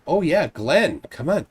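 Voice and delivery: usual speaking voice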